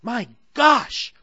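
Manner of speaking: put-on high, loud shocked little girl voice; girl blurting out her shock; gasping Sally. Sally gasping at the queen's news, high and loud. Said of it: "Sally gasped".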